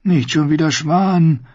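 ordinary delivery

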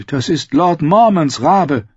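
lightly voiced young man calling out. A narrator's reading. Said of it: "hörte Samwell Tarly rufen".

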